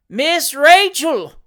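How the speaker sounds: slow, high, very loud bellow; roar. A narrator's reading. Fat boy repeating the name to mistress, roaring. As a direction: roar the name for someone deaf. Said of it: "roared the fat boy".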